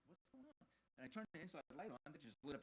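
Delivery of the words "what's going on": high startled question; a sudden bewildered cry; confused alarm. Retold or imitated. Waking alone in the dark car, startled.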